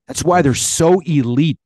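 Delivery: insistent build; emphatic. Real, not acted. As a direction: drive the point home.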